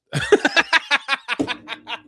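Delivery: a high, near-crying burst of laughter; high wheezing laugh. Real, not acted. Cracking up after his Chinese joke. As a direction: lose it completely.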